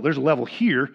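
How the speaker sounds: usual speaking voice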